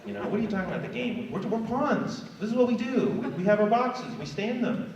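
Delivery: high, baffled pawn voice, quick at times; a pawn who can't grasp what yesterday even is; confused pawns. Retold or imitated. Obedient pawns answering the rebel pawns, high and baffled. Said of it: "the other pawns are like".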